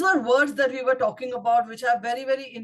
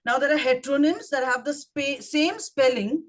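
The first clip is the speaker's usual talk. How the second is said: usual speaking voice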